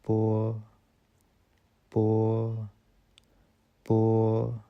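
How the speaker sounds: पो said as a crisp unaspirated po; पो, the Chinese b sound. Shown as a demonstration. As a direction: clip पो short, lips together then open. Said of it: "इसको हम बोलते हैं".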